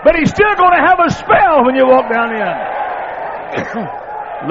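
high, loud, slow shouted preaching; preacher shouting the point to his congregation; preaching at full force. Real, not acted. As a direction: thunder it out to the congregation. Preaching climax.

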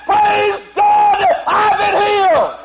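high, loud, slow shout of praise. Retold or imitated. Healed man shouting to God, high and loud. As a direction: shout it out, overjoyed. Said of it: "with a loud voice, he said".

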